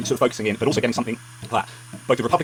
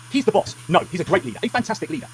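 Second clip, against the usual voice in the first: mocking; 7 semitones higher than usual. Mocking Trump's praise of Orban.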